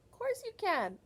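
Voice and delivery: high, bright of course; encouraging. Real, not acted. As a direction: cheer them on. Encouraging the children.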